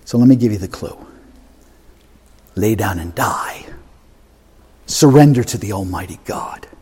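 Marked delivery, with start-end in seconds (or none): 2.5-6.7 low hushed whisper; whispered plea. Real, not acted. Preacher urging the congregation to surrender, whispering. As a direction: breathe it out as a tender surrender.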